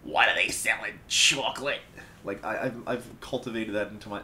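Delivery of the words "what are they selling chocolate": SpongeBob chocolate lady. Retold or imitated. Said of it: "the SpongeBob episode ... lady".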